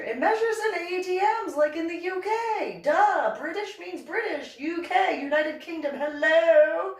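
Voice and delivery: high, rapid-fire "duh" rant; hammed-up incredulous outburst; exasperated sarcasm. Retold quarrel, stating the obvious.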